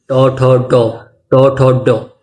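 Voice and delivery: टो ठो डो with the tongue curled back to the roof of the mouth; retroflex to tho do. Shown as a demonstration. Teacher showing students where the tongue goes, retroflex syllables. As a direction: tap टो ठो डो crisply off the palate. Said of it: "जीभ ऊपर मूर्धा पे ले जानी है".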